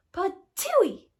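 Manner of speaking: high, loud spat-out patooey; spitting patooey. Acted. Goldilocks spitting out too-hot porridge, high loud patooey. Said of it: "cried Goldilocks, and she spat it out".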